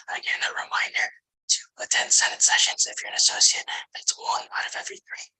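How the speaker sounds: steady unvoiced whisper into the mic. Real, not acted. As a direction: whisper the whole report, voice gone. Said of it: "Sorry, I have to whisper".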